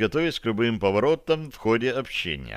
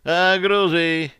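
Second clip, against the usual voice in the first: higher, drawn-out call; raised, stretched-out hail; 7 semitones higher, 4 dB louder, much slower than usual. Acted. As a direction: call slowly through a door. Calling from behind a door.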